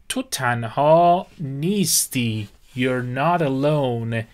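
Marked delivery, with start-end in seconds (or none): 2.8-4.2 slow, stress on not; slow model phrase. Shown as a demonstration. Teacher presenting the phrase to students, slowly. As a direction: say it slowly and clearly. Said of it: "Alone یعنی تنها".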